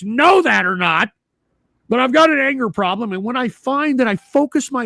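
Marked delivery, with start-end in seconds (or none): none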